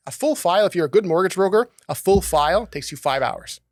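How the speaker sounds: ordinary talk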